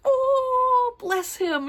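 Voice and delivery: high, gushing aww over a sweet character. Retold or imitated. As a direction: melt into a squealy bless him.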